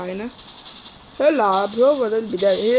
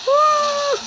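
high yelp; 4 dB louder, much slower than usual. Real, not acted. Sudden yelp mid-game.

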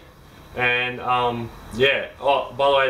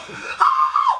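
high pained shriek; 3 dB louder than usual. Retold or imitated. Mimics burn returning.

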